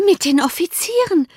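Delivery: put-on high, excited girlish question; Lydia exclaiming. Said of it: "rief Lydia".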